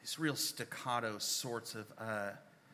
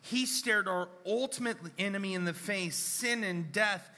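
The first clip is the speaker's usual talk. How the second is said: raised, fervent gospel proclamation; preaching at full force; 7 semitones higher, 4 dB louder than usual. Real, not acted. Preacher to congregation, declaring Jesus conquered death, full-force preaching. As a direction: lift the church with rising conviction.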